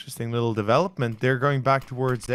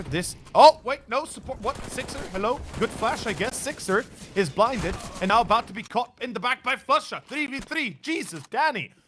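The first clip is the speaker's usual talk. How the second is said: excited; 8 semitones higher than usual. Real, not acted. Excited casting of a clutch.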